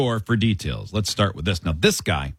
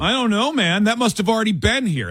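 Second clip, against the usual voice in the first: playing dumb; 7 semitones higher than usual. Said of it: "suspect playing dumb, retold".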